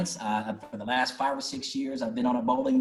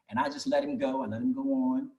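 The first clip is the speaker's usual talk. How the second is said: ordinary delivery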